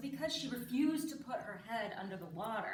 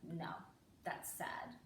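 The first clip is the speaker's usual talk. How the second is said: low, quiet deadpan; reply like a stony, unmoved kid; emotionless little boy; 6 semitones lower, 10 dB quieter, slower than usual. Retold or imitated. Son naming parent's expression, low deadpan. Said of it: "He was like the Vin Diesel of children".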